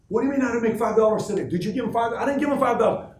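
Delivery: high, loud protest, fired back and forth; indignant quarrel. Retold or imitated. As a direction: act out a heated family argument. Acted family quarrel over five dollars.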